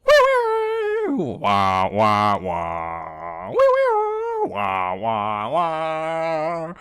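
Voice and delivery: high wailing wee wah noises; baby cry. Retold or imitated. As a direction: squeal then wail like a baby. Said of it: "It's like".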